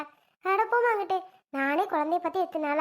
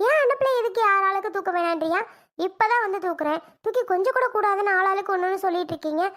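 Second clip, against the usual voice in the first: raised, louder, upset protest; hurt and furious, higher and louder; angry outburst; 3 semitones higher, 4 dB louder than usual. Acted. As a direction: fight back hard against being told off. Arguing over holding the baby.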